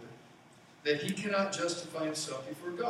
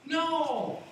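emphatic no; 12 semitones higher, 5 dB louder than usual. Real, not acted. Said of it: "answering his own question".

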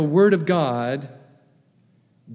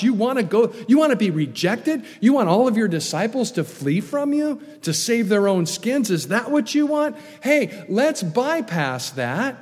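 higher-pitched, lightly voiced tempter; Satan's suggestion; 6 semitones higher than usual. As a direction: press the listener with incredulous questions, as a devil would. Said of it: "Satan's sinful suggestion".

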